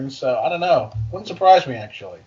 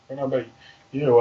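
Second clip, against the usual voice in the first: usual speaking voice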